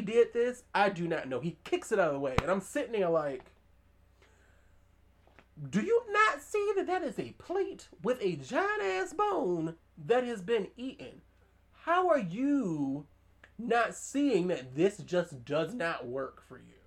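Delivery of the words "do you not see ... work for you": high, drawn-out incredulous scolding; exasperated disbelief. Retold or imitated. Viewer scolding a movie character who misses the danger, exasperated. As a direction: spell it out slowly in utter disbelief. Her own exasperated reaction, retold.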